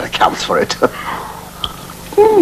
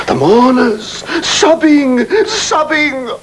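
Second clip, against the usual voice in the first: melodramatic recitation; 5 semitones higher, slower than usual. Acted. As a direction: wail the mourners' grief like an old stage ham. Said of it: "The burial of little Nell".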